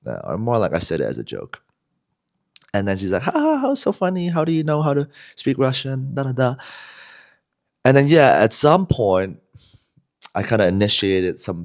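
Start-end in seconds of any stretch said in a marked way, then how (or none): 3.2-6.6 much higher imitation of her laughing reply; mock girl voice. Retelling the Belarusian girl's reply, hammed-up high mimicry. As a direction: lightly mimic a giggly bar girl.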